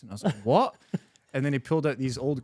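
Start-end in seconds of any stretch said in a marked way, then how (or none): 0.4-0.7 loud, high baffled "what?"; short, raised retold exclamation of disbelief; incredulous. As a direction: react with mock bewilderment. Baffled reply to boots offer in retelling.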